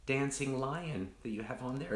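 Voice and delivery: high, sweet mock-cute friend voice; imitating a friend. Friend admiring the mug, retold in a high cooing voice. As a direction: coo it like a gushing friend. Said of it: "and she said".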